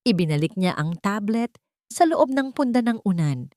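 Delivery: ordinary delivery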